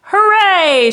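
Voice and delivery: loud, high cheer; triumphant shout. Acted. Yertle cheering from his high throne, loud and high. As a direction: crow with glee at the top. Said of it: "shouted Yertle".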